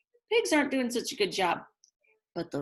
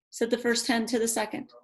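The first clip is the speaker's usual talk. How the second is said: usual speaking voice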